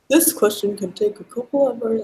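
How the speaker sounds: lightly done robot voice; faint robot voice, barely put on. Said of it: "I'm gonna do the robot voice".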